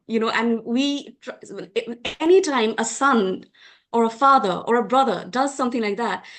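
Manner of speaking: normal talking voice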